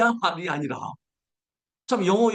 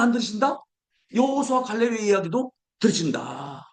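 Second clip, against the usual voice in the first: ordinary talk, nothing marked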